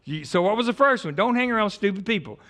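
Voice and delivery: ordinary talk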